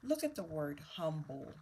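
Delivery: normal talking voice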